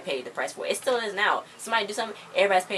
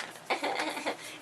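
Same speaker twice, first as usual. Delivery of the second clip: quick breathy laugh; a real little laugh; short laugh; 10 semitones higher, 7 dB quieter than usual. Real, not acted. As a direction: burst out laughing, high and airy. Laughs at her own retelling.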